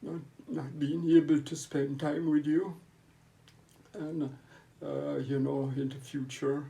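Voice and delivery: high, loud, voice cracking with grief; choked up, pushing words through tears; tearful goodbye. Real, not acted. Farewell to a dying brother.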